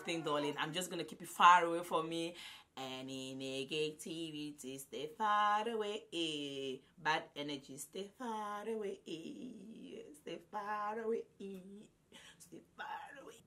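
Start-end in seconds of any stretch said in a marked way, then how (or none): 2.8-11.2 mock incantation. Banishing negativity, chant-like. 12.4-13.3 a last secret hex, whispered. Pretend. Final banishing of negativity.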